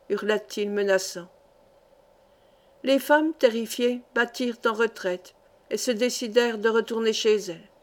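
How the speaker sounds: usual speaking voice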